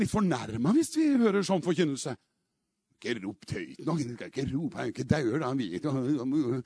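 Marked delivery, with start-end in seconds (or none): 3.0-6.6 low, soft mock grumble; imitated grumbling objector. Mimics offended people objecting to shouting.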